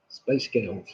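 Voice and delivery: soft, low try at an American accent. Shown as a demonstration. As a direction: say it quietly with an American twang. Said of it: "in an American accent".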